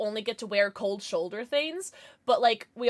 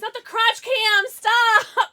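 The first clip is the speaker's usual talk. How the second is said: a sharp, high, loud Stop; embarrassed shout; 10 semitones higher, 8 dB louder, much slower than usual. Real, not acted. Streamer to chat about the crotch cam, a loud high yelp. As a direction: yelp it out, caught off guard.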